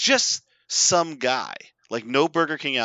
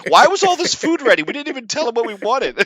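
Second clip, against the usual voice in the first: much higher and louder, incredulous; amazed friends; 10 semitones higher, 9 dB louder, faster than usual. Retold or imitated. Friends in the back seat ask, high and incredulous. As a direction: squeal in disbelief.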